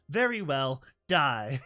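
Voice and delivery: loud, cruel death sentence; evil nightmare voice; cold villain. Acted. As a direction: pronounce his doom with relish. Nightmare kills the pony.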